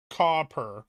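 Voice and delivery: Southern accent, "copper" with the O drawn out long and falling; southern drawl copper. Shown as a demonstration. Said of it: "copper with a long drawl on the O".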